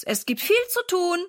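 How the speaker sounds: high-pitched rallying call; mild call, lifted in pitch. A narrator's reading. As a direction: keep calling to the household. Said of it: "ruft er durch das ganze Haus".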